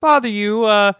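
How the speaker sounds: player's character calling to the closed door; yelled call. Acted. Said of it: "I'm gonna yell".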